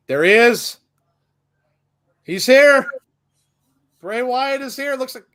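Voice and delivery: high, loud, thrilled; excited. Real, not acted. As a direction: burst out as he appears. Wrestler appears, excited watcher.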